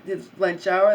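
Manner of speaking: ordinary talk, nothing marked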